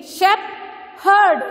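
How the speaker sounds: "shepherd" as shep, herd with a gap, first beat high; "shepherd" sounded out in two parts; syllable by syllable. Shown as a demonstration. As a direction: break "shepherd" into two clear beats. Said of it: "So it is two syllable".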